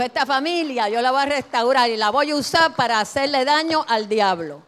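loud, triumphant proclamation; the Lord's declaration. Acted. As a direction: declare it like God's own promise, full voice. Voicing the Lord's promise.

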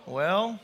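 "Well" rising like a question; "Well" with a question mark at the end. Shown as a demonstration. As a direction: lift "Well" upward as an attentive listener. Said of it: "there's a question mark at the end".

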